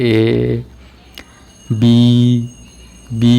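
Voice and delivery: ଏ and ବି drawn out one at a time; sounding out letters. Shown as a demonstration. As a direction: say ଏ, ବି, ବି as long letter sounds, pausing between each. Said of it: "sound କରିକି ଏମିତି ଘାରିବେ". (ଏ, ବି, ବି ଅକ୍ଷରକୁ ଲମ୍ବା କରି ଅଲଗା ଅଲଗା ଉଚ୍ଚାରଣ)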